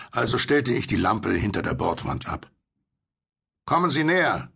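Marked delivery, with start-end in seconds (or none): 3.7-4.5 high, raised call. A narrator's reading. Will invites the stranger's boat closer, calling out. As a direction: call over the water to coax them in. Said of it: "rief Will".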